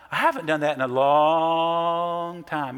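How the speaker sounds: long stretched slow and leaned on; stress on long. Retold or imitated. Daughter pressing dad, stress on long. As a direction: lean into the word long, slowing down. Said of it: "emphasis on long".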